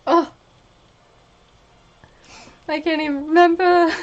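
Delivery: normal talking voice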